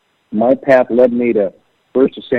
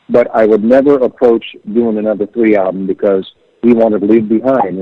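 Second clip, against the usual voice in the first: ordinary delivery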